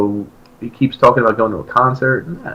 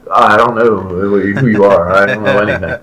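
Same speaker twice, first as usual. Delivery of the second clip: lightly voiced clueless guy; 4 semitones lower than usual. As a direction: play the bored stranger. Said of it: "And he's like".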